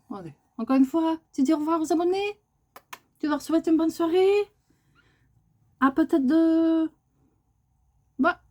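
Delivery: high coaxing pet talk; high sing-song coaxing. Real, not acted. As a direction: coax the bird to wave goodbye. Coaxing bird to say goodbye.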